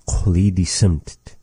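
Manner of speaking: usual speaking voice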